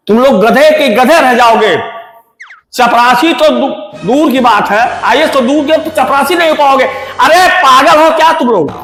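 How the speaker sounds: loud, exasperated teacher's tirade; angry scolding. Acted. Teacher telling off students who laugh at him, angry scolding.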